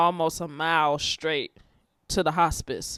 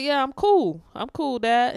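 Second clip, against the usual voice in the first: higher, brighter "I'm cool" brush-off; mock cheerful reassurance; 8 semitones higher, 4 dB louder than usual. Reassuring dying dad he's fine, upbeat and high. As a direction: play it off as totally fine for Dad.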